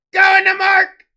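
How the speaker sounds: big, high, loud shout of the winner; excited shout. Real, not acted. Breaker announcing the winner to chat, loud excited shout. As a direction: yell it to the whole room. Telling viewer he won the card.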